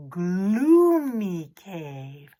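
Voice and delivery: ordinary delivery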